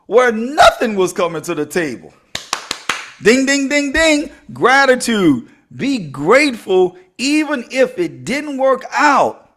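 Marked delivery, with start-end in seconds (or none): 3.2-4.3 high, ringing ding-ding-ding; imitated bell dinging. Speaker signalling the key lesson to the audience, imitated bell. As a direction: chime it like a winner's bell.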